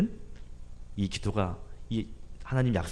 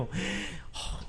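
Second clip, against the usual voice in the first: sarcastic scoff; 8 semitones higher, 9 dB quieter than usual. Retold or imitated. Sarcastic gasp of the spies.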